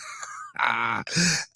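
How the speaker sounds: real laugh, starting breathy and rising. Real, not acted. Host recalls what his friend told him, laughing. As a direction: crack up and let the laugh climb. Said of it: "I'm laughing 'cause he told us some".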